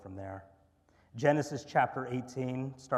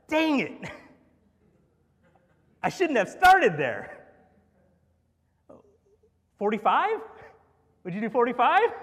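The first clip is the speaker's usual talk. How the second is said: much higher, louder self-scolding; comic exasperated Abraham; 11 semitones higher, 14 dB louder than usual. Acted. Pastor voicing Abraham regretting his opening bid, high and loud. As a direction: kick yourself for a bad opening bid, loudly.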